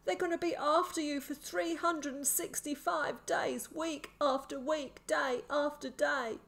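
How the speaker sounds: high, desperate madman's voice, slowing toward the end; terrified warning. A narrator's reading. Sick man warns boy of year-long hunt, terrified. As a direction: make him grasp the danger. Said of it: "His eyes were wide with terror".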